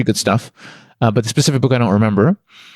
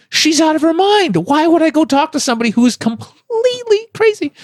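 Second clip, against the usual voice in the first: incredulous protest. Retold or imitated. Arguing with the thought to go talk to her, incredulous. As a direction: act out your baffled inner objection, voice way up. Own incredulous reaction, acted in story.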